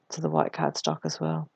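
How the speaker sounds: normal talking voice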